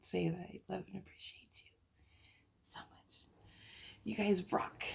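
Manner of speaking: whispering gratitude to her clients; emotional whisper. Real, not acted. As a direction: whisper it straight to the people you love.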